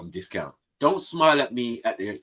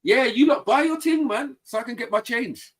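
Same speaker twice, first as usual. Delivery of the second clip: incredulous raised voice; 11 semitones higher than usual. Retold or imitated. Telling the cashier with no change to sort herself out, mock indignant and much higher. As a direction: pitch jumps much higher in mock disbelief. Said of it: "I said".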